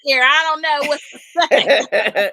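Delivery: mock her words while cracking up; laughing imitation. Friend mimics LaShawn's arrival, laughing. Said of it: "LaShawn said".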